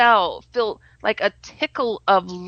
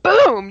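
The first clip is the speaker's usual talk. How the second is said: mock crash sound; 10 semitones higher, 7 dB louder than usual. Falling out of bed.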